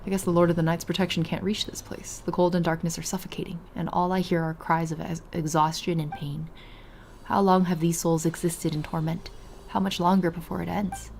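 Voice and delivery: soft, quiet story reading; reading dialogue. Acted. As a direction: read it like a game narrator. Reads game dialogue aloud.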